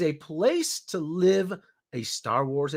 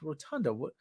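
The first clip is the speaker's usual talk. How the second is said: confused mutter; 3 semitones lower, 11 dB quieter than usual. Retold or imitated. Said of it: "I'm like".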